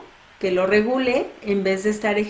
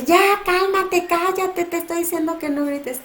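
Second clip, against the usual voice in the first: yelling parent; 9 semitones higher than usual. Retold or imitated. Parent yelling at tantruming child to stop, high exasperated shout. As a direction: snap at a screaming kid, pitched way up. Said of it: "si nosotros estamos gritándole todo el tiempo".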